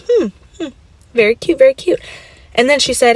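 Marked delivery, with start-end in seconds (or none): 0.0-2.0 unimpressed grandma. Retold or imitated. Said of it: "she was just like".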